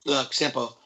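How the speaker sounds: normal talking voice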